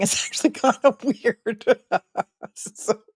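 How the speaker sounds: words broken up by giggles; can't hold back a laugh. Real, not acted. Host laughing at a rude tweet, giggling.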